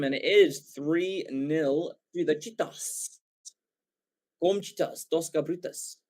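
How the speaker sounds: slow, playful put-on Afrikaans accent; mock Afrikaans accent. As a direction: ham up an Afrikaans accent on the score call. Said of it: "my funny but terrible Afrikaans accent".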